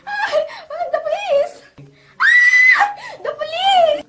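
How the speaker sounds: very loud, high screams with crying; woman under attack screaming into the phone; screaming for help. Acted. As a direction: shriek for the police in mortal terror. Screaming for police while attacked.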